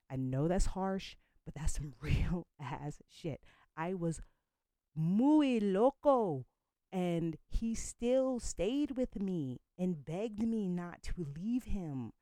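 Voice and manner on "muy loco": very slow, loud, higher muy loco; drawn-out emphasis. Real, not acted. Admitting her wild past.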